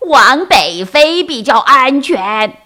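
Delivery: slow, loud, high crow yell; drawn-out insistent shout in a bird voice; crow's loud shout. A narrator's reading. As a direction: holler it as a squabbling crow. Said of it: "乌鸦大喊".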